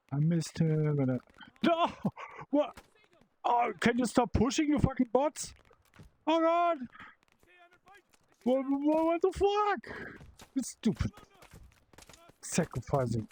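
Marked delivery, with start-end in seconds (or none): 1.6-11.1 frustrated yelling at bots. Real, not acted. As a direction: blow up at the dumb bots shoving you. Raging at pushing bots.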